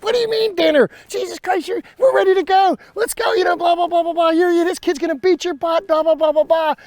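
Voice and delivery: very high, loud retold rant at his son; acted-out screaming pep talk; screaming dad. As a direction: holler it like a fired-up wrestling dad. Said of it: "And I'm screaming out".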